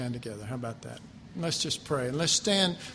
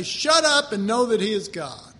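much louder, forceful rebuke; blunt loud command; 3 semitones higher, 8 dB louder than usual. Retold or imitated. Preacher commanding his soul to know God, loud. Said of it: "I say".

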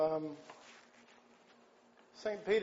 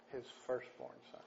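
"His firstborn son" breathed out softer, almost a whisper; whispered echo; 3 semitones lower, 11 dB quieter, faster than usual. Real, not acted. Preacher to his congregation, hushed for weight.

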